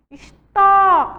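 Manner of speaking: histó broken off after a long, high, loud TÓ; stress on TÓ. Shown as a demonstration. Teacher isolating the strong syllable, histó with long loud TÓ. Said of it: "a sílaba forte aí é o tó".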